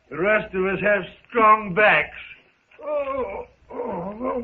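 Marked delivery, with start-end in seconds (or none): 2.8-4.4 soft pained oh oh oh; creaking old back groans; wincing little moans of a stiff back; groans of stiffness. Acted. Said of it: "mine's a little stiff".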